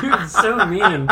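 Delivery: mock hurt, giggling; laughing you're so mean; laughing whine. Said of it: "I was like".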